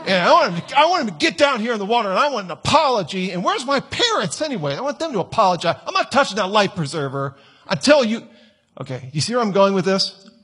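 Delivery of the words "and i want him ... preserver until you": put-on high, whiny voice of a spoiled teen; entitled teenager. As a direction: demand it like a spoiled brat. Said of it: "just going".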